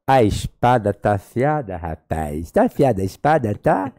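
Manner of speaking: slow, teasing pastor voice; imitating his pastor. Imitating his pastor teasing the professor, slow. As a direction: drawl the question like a playful old pastor. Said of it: "vou imitar a voz dele".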